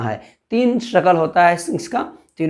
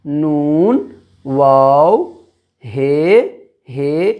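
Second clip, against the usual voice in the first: reciting letter names; 2 semitones lower, much slower than usual. Shown as a demonstration. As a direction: call out each letter for the class to repeat. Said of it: "उसके बाद क्या है?".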